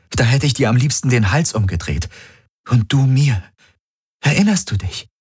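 a woman begging him to remember her; Kirai, desperate. A narrator's reading. Kirai pleading with Galen.

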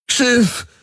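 hoch gekeuchter Ruf. A narrator's reading. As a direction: atemlos rufen. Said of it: "keuchte Garland". (gekeuchter Befehl)

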